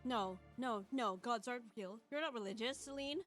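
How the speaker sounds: usual speaking voice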